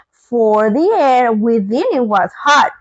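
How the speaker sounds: ordinary delivery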